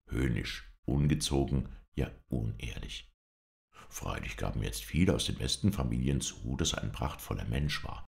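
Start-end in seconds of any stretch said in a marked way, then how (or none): none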